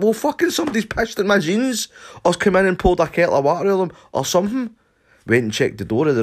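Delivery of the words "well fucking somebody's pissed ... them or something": high, loud ranting accusation; furious accusation. Retold or imitated. Shouting at Stumpy about the puddle on the carpet, high and loud. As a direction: rant at him, insisting. Arguing with friend.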